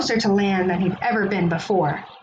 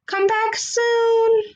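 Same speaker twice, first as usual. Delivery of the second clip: much higher, slow, sing-song farewell; farewell unicorn; 11 semitones higher, much slower than usual. Acted. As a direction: call it out sweetly and slowly. Unicorns say goodbye.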